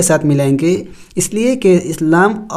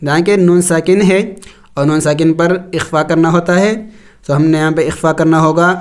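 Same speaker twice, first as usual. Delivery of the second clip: ordinary delivery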